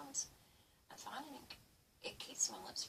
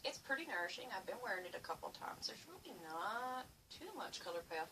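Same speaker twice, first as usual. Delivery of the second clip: ordinary delivery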